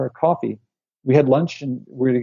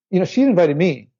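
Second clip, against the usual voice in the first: usual speaking voice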